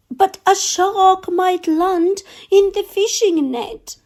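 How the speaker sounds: high, frightened cook voice; scared cook. Acted. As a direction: squeak out the cook's fear, pitched high.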